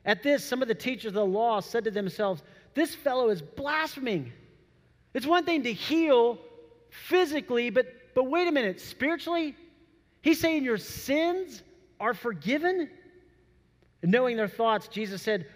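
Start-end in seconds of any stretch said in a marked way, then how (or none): 2.7-12.9 higher, incredulous objection; indignant disbelief. Retold or imitated. Teachers of the law muttering among themselves, higher incredulous scoffing. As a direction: scoff like you can't believe his nerve.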